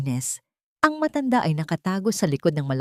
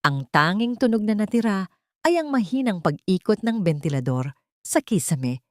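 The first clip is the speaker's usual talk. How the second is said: plain reading voice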